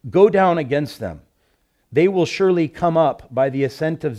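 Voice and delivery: loud, ringing Bible reading; preacher reading a prophecy aloud; scripture reading. Acted. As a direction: proclaim the verses to the congregation. Reads scripture aloud with force.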